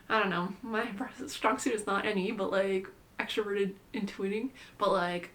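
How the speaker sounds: ordinary talk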